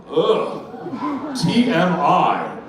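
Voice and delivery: drawn-out mock revulsion; groan, then spell it out slowly; disgusted woman. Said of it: "one of the women said".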